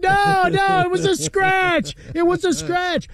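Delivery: high, slow mock-defensive denial; Seinfeld quote. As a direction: play it like a sitcom character caught red-handed. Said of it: "we all remember the Seinfeld episode".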